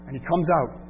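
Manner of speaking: normal talking voice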